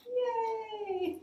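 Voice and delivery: high bright yay; cheer. Real, not acted. Cheering after the song.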